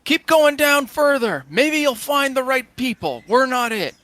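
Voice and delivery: high, loud dismissive shout; shouted brush-off across the road; screaming back. Acted. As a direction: yell them away from a distance. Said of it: "[name] kinda screams back".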